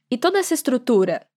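normal talking voice

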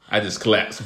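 ordinary delivery

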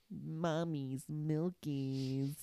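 slow, soft drawn-out meme voice; TikTok sound imitation. Imitating dad's phone sound in the store, slow and soft. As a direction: draw it out like the silly clip.

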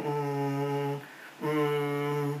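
long, low, soft "hmm" of doubt; drawn-out pondering hum, low and soft; hesitant student hum. Retold or imitated. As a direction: imitate unsure students humming quietly. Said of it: "mulai diem sebagian".

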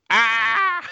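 short sudden hammed-up scream; a quick fake panic yell, high and loud; mock panicked scream. Screaming trapped in sarcophagus.

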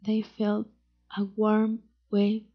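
ordinary delivery, nothing marked

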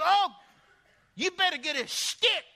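high, loud, urgent warning; grandmother's warning. Retold or imitated. Grandmother to grandson going for a walk, emphatic warning. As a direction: imitate an alarmed grandmother warning the children.

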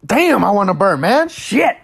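high, loud exclamation of discomfort; real frustrated cursing; exasperated outburst. Real, not acted. Groaning about needing to burp.

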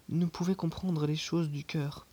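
ordinary delivery, nothing marked

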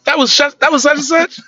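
high, loud shocked exclamation to his cousin; stunned exclaim. Retold or imitated. Visitor shocked at cousin's little girl cursing, high and loud. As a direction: gasp it out in disbelief. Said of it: "I say".

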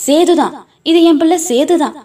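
high, excited cry of a mother; joyful shouting. A narrator's reading. Mother announcing her returned son, high joyful cry. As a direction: shout it to everyone around. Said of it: "சுற்றி சுற்றி கத்தினாள்".

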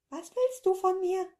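high creature echo. Acted. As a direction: parrot the question in a tiny creature voice. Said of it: "echote die Flauschkugel".

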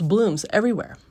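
usual speaking voice

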